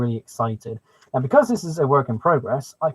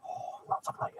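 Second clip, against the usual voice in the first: whisper; 16 dB quieter than usual. Real, not acted. Gleeful aside.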